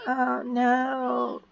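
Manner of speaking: high, drawn-out "oh no" wail; mock dismay. Shadow as her memory gets erased, mock dismay. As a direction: play a helpless victim, lightly hammed-up. Victim voiced as memory is scattered.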